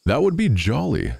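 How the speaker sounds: high, lightly cried exclamation; delighted cry. A narrator's reading. Miller hears of cakes under the bed, crying out. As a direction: pitch leaps up in delight. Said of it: "cried the miller".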